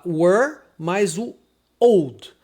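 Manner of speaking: put-on "were" and "old" as separate words; two slow, separate English words; split into two words. Shown as a demonstration. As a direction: sound out "were", pause, then "old". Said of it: "como se você pronunciasse duas palavras".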